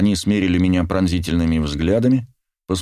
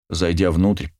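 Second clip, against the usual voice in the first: plain reading voice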